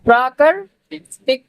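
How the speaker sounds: प्राकृतिक said at half speed, falling at the end; slowed word. Shown as a demonstration. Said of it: "पहले पै की आवाज आ रही है". (धीमे से प्राकृतिक)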